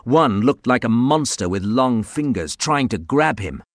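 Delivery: ordinary delivery, nothing marked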